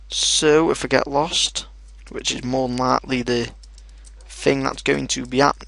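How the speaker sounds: usual speaking voice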